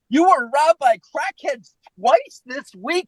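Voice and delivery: high, drawn-out disbelieving question; slow, high-pitched mock disbelief; incredulous. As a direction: imitate a mesmerized woman's astonishment. Lauren mesmerized, repeating in disbelief.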